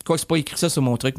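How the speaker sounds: normal talking voice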